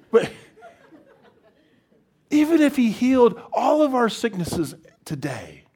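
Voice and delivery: loud, high, impassioned declaration; emphatic preaching. Real, not acted. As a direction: proclaim it forcefully to the room. Preacher driving home the main point.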